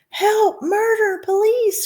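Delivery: high, shrieking cries; hysterical scream. A narrator's reading. Mother shrieking for police as her son vanishes. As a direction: scream in total panic. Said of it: "screamed Missus Gloop".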